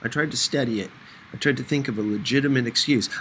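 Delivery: usual speaking voice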